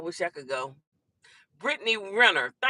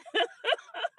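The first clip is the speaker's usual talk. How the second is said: high laugh; 11 semitones higher than usual. Real, not acted. Laughing at commenter.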